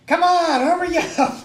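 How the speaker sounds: very high, very loud holler; yelling. Acted. Player stepping down the stairs to hurry friends, yelling. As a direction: urge them on at a full yell. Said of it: "I'm just yelling".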